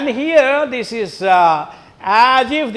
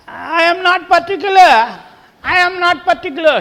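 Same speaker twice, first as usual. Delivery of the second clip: higher and fast, a quick noncommittal reply; hesitant Ben; 10 semitones higher, 6 dB louder, faster than usual. Acted. Ben answering his wife, hurried and noncommittal. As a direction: brush the question off, quick and high.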